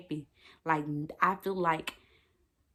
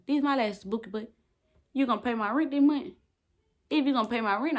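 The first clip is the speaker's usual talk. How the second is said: high, mocking challenge; sassy retort; 6 semitones higher, faster than usual. Real, not acted. To whoever mocks her lashes, a sassy high challenge. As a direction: throw the question back with sass.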